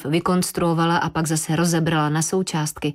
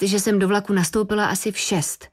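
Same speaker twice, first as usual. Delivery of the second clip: ordinary delivery, nothing marked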